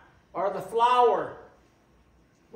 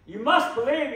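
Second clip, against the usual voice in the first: ordinary talk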